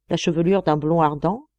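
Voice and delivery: ordinary delivery, nothing marked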